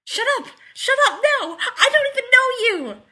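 very high, loud panicky yelling; shrieked, flustered denial; strangled yelp. A narrator's reading. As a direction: yelp it in mortified protest. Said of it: "gave a strangled yelp".

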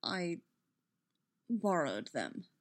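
halting, soft, dropping low; struggling for words. A narrator's reading. Obi-Wan explaining the lights, halting and reluctant. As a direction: pause long after the first word, then finish quietly. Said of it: "visibly struggled for the words".

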